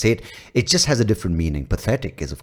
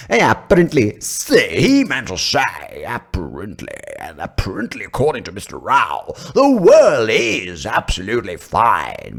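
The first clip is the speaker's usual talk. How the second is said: panel translator. Acted. Panel explaining what Mister Rao meant, drawn-out mock importance. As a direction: relay his meaning with stretched, mock-weighty words.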